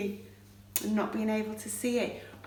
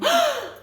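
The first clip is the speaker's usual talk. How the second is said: sharp, high intake of breath; big theatrical gasp; amazed gasp; 6 dB louder than usual. Shown as a demonstration. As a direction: gasp loudly in sudden wonder. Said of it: "and then go".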